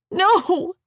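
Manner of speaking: a loud, high, tearful no; sobbing cry. Acted. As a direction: cry it out in protest. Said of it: "I sobbed".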